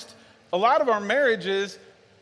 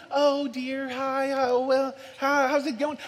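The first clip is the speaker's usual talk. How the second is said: high, put-on pleasant chitchat; mock polite; 5 semitones higher than usual. Dad mimicking the tone his kids want, mock polite. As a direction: parody someone who never sounds mad. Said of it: "I don't talk like that".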